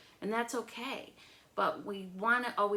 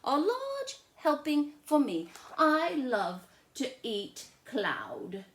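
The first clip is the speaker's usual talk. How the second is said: put-on higher, slower, louder playful little girl; Lola's voice; 6 semitones higher, 5 dB louder, slower than usual. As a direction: savour the words like a greedy child. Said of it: "said Lola".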